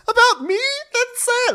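very high offended squeal; mock indignant shriek. Host mimics Jen taking offence, high mock shriek. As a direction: squeak it in exaggerated outrage. Acted offended housewife in quarrel.

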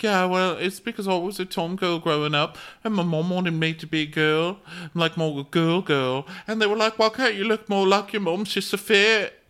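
high, whiny put-on voice rising as it goes; Courtney. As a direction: imitate a tearful confession in falsetto. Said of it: "Courtney's like".

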